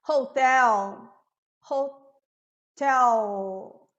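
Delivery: "Hotel" ending in a drawn-out dark L. Shown as a demonstration. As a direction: hold the final L of "Hotel" dark. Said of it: "é um dark L". ("Hotel" com L escuro prolongado)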